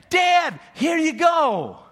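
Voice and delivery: loud, high, proud call to his dad; excited son calling out to his father; eager shout. Retold or imitated. Esau proudly bringing the dish.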